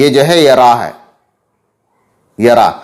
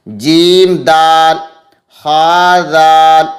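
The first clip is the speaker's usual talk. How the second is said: each letter name slow and louder; slow letter drill; 5 dB louder, much slower than usual. Shown as a demonstration. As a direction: spell out syllables for children, slowly and clearly. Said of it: "किस तरह लिखा जाएगा".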